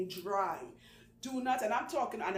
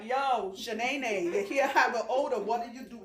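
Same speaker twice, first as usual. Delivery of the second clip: blunt call-out; 6 dB louder than usual. Retold or imitated. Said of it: "I'll be like".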